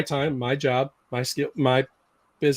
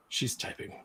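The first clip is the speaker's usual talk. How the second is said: whisper; 17 dB quieter than usual. Real, not acted. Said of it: "watching Christiana type".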